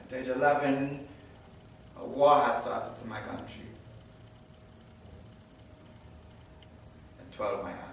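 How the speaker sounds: soft, low account of loss; somber. Acted. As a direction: tell it quietly, with restrained grief. Recounts war and family killed.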